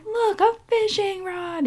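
very high, drawn-out mock boyish wonder; excited kid. Boy shows mom the fishing rod, high excited imitation. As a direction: imitate a child showing off a find.

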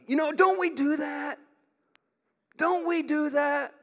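high, shouted rhetorical question; impassioned challenge. Real, not acted. Preacher confronting his congregation, impassioned shout.

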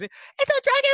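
extremely high, frightened goblin squeal; panicking Boogers. Acted. Goblin spotting a dragon, panicked little goblin voice. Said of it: "have Boogers have a panic attack".

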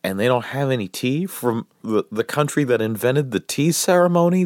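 ordinary delivery

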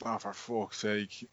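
usual speaking voice

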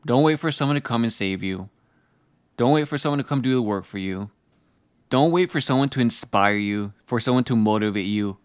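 ordinary talk, nothing marked

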